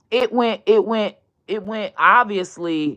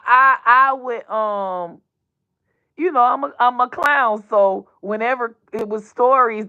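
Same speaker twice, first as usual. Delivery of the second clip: ordinary delivery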